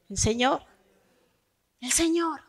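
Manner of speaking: usual speaking voice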